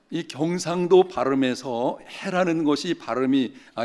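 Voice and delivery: usual speaking voice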